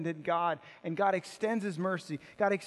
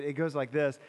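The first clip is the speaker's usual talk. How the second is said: ordinary delivery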